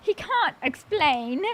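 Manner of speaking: put-on slow, deliberate British accent; playful British-accented kulak. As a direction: put on British vowels for fun, drawn out. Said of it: "gonna do it with a British accent".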